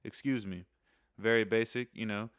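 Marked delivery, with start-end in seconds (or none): none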